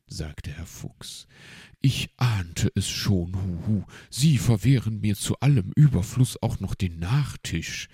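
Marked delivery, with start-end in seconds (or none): 1.8-7.9 loud, lightly indignant owl; complaining. A narrator's reading. Uncle Uhu denied even his dessert, loudly complaining. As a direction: protest with heavy emphasis. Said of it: "beschwerte er sich".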